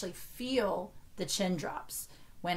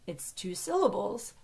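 usual speaking voice

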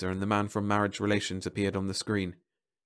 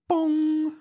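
high ringing imitated gong; machine bong; 5 dB louder than usual. A narrator's reading. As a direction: sound a high alarm chime with the voice. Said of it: "went the Monitex".

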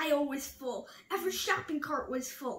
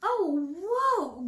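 groan; 4 semitones higher, 7 dB louder than usual. A narrator's reading. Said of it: "groaned Walrus".

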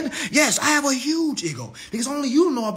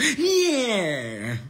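mocking losers. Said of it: "They be sitting beside each other".

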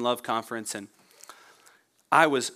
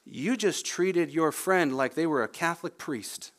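ordinary delivery

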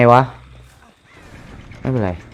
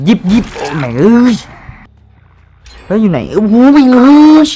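high, loud slow swearing; frantic gamer curse; panicked cursing; 9 semitones higher, 6 dB louder, slower than usual. Real, not acted. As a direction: curse in real alarm. Caught by killer, cursing.

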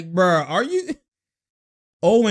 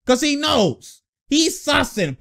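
ordinary delivery

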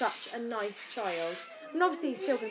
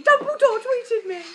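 much higher, louder laughing brag; gleeful boast; 12 semitones higher, 11 dB louder than usual. Retold or imitated. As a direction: show off the news laughing. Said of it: "it's been".

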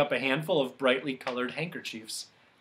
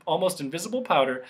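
plain reading voice

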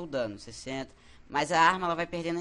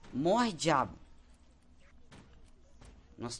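ordinary delivery

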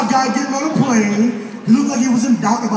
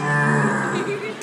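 very low, softer rolling growl; negative-energy growl; 5 dB quieter than usual. Retold or imitated. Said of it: "negative energies".

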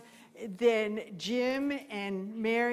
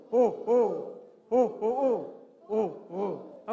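great horned owl hoot; 2 semitones lower, 3 dB louder, slower than usual. Shown as a demonstration. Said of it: "great horns".

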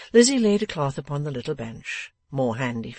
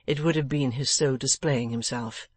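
plain reading voice

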